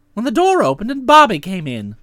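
ordinary delivery

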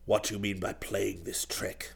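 very low, hushed interrogation; stern uncle. A narrator's reading. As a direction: question the nephew softly, deep in the voice. Duke confronts nephew.

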